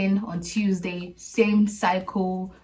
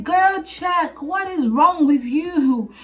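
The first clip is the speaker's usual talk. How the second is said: much higher, louder exasperated push; impatient friend; 9 semitones higher, 6 dB louder, much slower than usual. Retold or imitated. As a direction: friend losing patience, demanding she check. Friend urging her to check.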